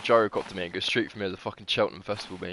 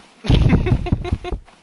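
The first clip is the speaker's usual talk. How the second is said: high laugh; 11 dB louder than usual. Real, not acted. Laughs at gyrocopter.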